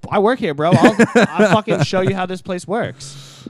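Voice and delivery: loud, high mock rant at a customer; exasperated outburst. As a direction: retell losing it with a stubborn guest.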